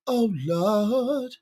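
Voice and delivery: high, drawn-out wail; mock dismay. Streamer reacting to his own teasing, mock dismay. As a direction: stretch it out high, rising.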